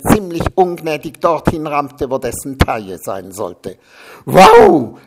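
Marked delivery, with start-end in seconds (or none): 4.2-5.0 sudden high, loud roar of pain; a yelped, much louder wow. A narrator's reading. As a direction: bellow it out from the gut. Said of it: "brüllte das Weichau".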